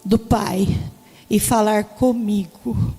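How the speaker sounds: reverent reading. Retold or imitated. Reads meaning found reverently.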